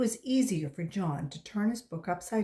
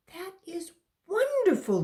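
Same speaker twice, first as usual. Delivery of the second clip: delighted teacher; 8 semitones higher, 4 dB louder than usual. Acted.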